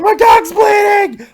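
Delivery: high, loud panicked yell, drawn out; panicked shout. Retold or imitated. Teen running round the room shouting in panic. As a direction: shriek it, running around in panic. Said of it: "running around the room like".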